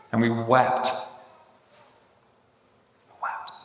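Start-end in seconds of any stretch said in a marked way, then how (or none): 3.2-3.5 hushed echo. Shown as a demonstration. Preacher echoing the psalm's last word, hushed.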